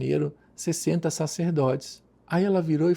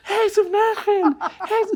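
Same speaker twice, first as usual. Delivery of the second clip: retold shriek, much higher and louder, slowed; hysterical scream; 5 dB louder, slower than usual. Nun seeing her brother's photo, shrieking hysterically. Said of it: "gritando histérica".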